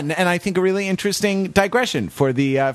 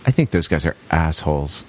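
much lower mock grouch; gruff low grumble; 10 semitones lower than usual.

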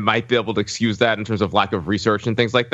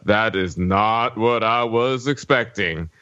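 put-on slow Southern accent, under the breath; slower than usual. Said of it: "were you doing a Southern accent there?".

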